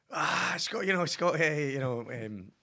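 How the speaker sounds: high, loud mock fan shout at passing fans; retold excited shouting. In a Soho bar, hailing passing Scotland fans with a high loud shout. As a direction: call out to the Tartan Army, high and loud. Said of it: "and all that shouting".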